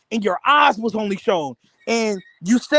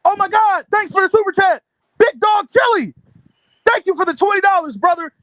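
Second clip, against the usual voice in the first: high-pitched, excited mock streamer; Fanon; 10 semitones higher than usual. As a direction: gush thanks like an over-excited host. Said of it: "I seen Fanon. He said".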